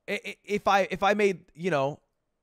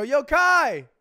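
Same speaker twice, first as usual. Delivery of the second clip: loud, higher call across the room; 10 semitones higher, 7 dB louder than usual. Retold or imitated. Ludwig calling Kai over, loud shout. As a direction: yell to get his attention. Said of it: "I flagged him over".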